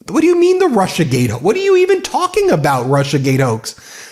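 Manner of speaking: can't believe what he's hearing; incredulous. Real, not acted. Host reacting in disbelief to the Russiagate claim, incredulous.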